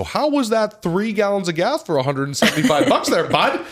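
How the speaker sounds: high mocking jab at the customer; mocking gloat. Taunting the customer over his bill, high mocking jab. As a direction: needle the customer, high and teasing.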